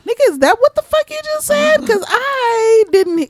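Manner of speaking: very high squeaky disbelief; incredulous retort. Retold or imitated. Host retells confronting coworker, high-pitched mock disbelief. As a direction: shoot up into falsetto bafflement. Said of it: "and I was like".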